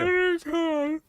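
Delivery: high, loud, slow protest; drawn-out plea that it happens every time; whiny insist. Real, not acted. Wife insists to streamer she always tears up, high whiny protest.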